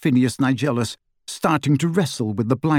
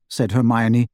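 plain reading voice

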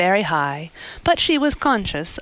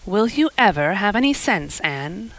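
ordinary delivery

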